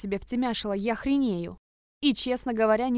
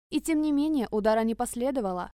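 ordinary delivery

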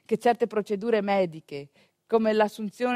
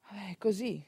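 whispered resignation; 8 dB quieter than usual. Real, not acted. Rassegnazione dopo il racconto.

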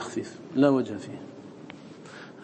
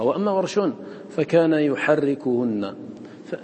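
normal talking voice

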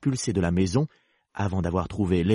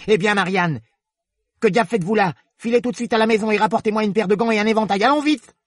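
high-pitched, fast, angry rabbit; colère; 11 semitones higher, 4 dB louder than usual. Acted. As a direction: order the maid off furiously, high and hurried. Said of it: "il l'interpella avec colère". (lapin en colère, ordres aigus et pressés)

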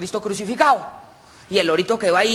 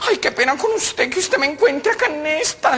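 high comic female voice; embarrassed woman; 8 semitones higher than usual. Acted. Paola is found at the brothel, embarrassed. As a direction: apologise in a flustered falsetto.

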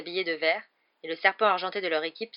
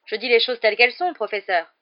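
defensive retort; 4 semitones higher, 9 dB louder than usual. A narrator's reading. Commentator defends himself to the professor.

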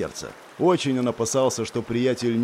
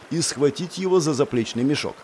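ordinary delivery, nothing marked

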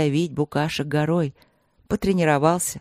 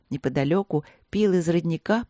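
usual speaking voice

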